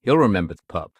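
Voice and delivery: ordinary talk, nothing marked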